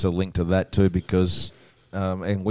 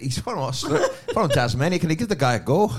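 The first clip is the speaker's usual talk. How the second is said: put-on Scottish accent; 9 semitones higher than usual. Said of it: "That was Scottish".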